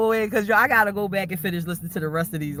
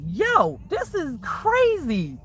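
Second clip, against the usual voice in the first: mock amazement; 9 semitones higher, much slower than usual. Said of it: "I'm like".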